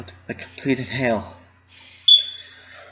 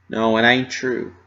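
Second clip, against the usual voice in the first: plain reading voice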